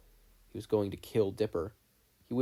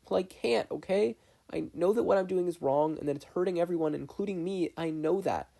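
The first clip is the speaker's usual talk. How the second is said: put-on despairing boy voice; 7 semitones higher than usual. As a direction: push back desperately as a kid. Dipper pushes back, despairing.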